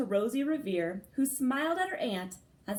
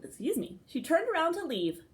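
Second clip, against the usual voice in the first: usual speaking voice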